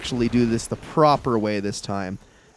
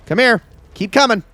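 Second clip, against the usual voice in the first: loud taunting; 8 semitones higher, 5 dB louder than usual. Real, not acted. Taunting a monster in game.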